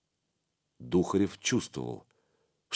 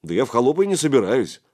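quick, louder, raised protest; reply fast in mild astonishment; taken aback; surprised; 4 semitones higher, 6 dB louder, much faster than usual. A narrator's reading. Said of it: "Духарев даже удивился".

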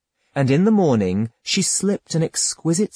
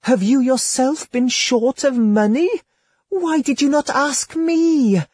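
higher, coaxing lady's voice, honeyed and intimate; seductive courtesan; 9 semitones higher than usual. Acted. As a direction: purr it to your lover like a temptress. Courtesan feigns reluctance.

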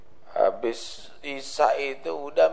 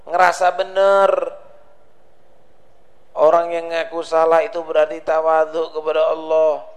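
louder, driving sermon voice; fiery preaching; 3 semitones higher, 6 dB louder than usual. Acted. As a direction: hammer the moral home to the congregation. Preaches at full force.

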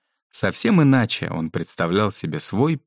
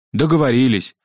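higher, louder, prompt agreement; eager joint answer; 6 semitones higher, 5 dB louder than usual. A narrator's reading. Two boys agree with the headmistress together, eager. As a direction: answer up like obedient pupils. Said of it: "ответили в унисон".

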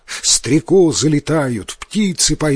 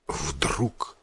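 usual speaking voice

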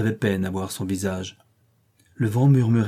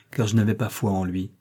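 ordinary delivery

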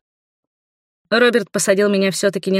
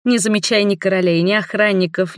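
ordinary delivery, nothing marked

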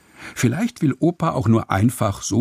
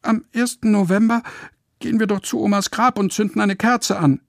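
viel höhere, dünne Piepsstimme; piepsig; 11 semitones higher than usual. Acted. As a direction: kleinlaut hoch piepsen. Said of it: "piepst Lotte". (Lotte piepst schüchtern einen Einwand)

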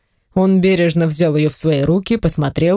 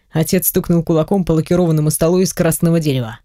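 usual speaking voice

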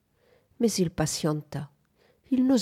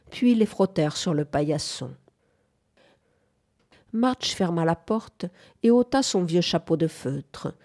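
ordinary delivery

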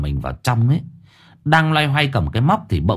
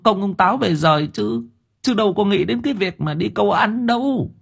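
wincing in pain; 9 semitones higher than usual. A narrator's reading. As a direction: explain while still smarting from the wound. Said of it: "nhăn nhó suýt xoa".

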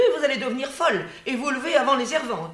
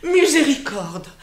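louder, slower exclamation of dismay; outraged cry; 7 semitones higher, 6 dB louder than usual. Acted. Nurse cries out in dismay at Antigone's yes. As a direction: throw up your hands at her.

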